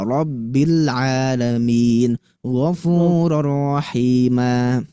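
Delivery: "Rabbil alamin, ghafurur rahima" read slowly with an ordinary forward ro; slow model recitation; plain ro. Shown as a demonstration. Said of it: "cara bacanya ro biasa".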